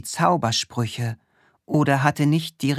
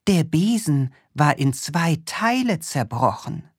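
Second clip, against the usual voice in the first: ordinary delivery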